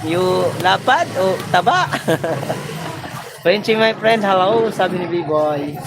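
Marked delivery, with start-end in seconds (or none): 2.0-3.4 breathy laugh. Real, not acted. Laughing after joke.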